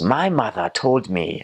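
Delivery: imitated slow, emphatic African accent; President Dube. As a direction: draw it out slowly in a church elder's accent. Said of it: "he goes".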